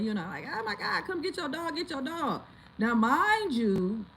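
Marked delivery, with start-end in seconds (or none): 0.5-2.4 high-pitched frantic cry, held back in volume; mock hysterical scream. Owner calling the neighbor to get his dog, mock hysterical scream. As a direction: imitate hysterical screaming without full volume. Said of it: "screaming like a crazy hysterical person".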